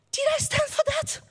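high, incredulous "did I stand for that?"; disbelief. Retold or imitated. A tourist asks himself in disbelief, stunned and high. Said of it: "and you're like".

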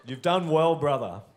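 hammy attempted Welsh accent. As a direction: have another try at Welsh, projected with a laugh. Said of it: "That was said with a Welsh accent".

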